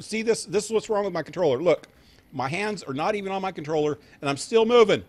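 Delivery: loud, high and fast in disbelief; excited. Real, not acted. Controller moving by itself.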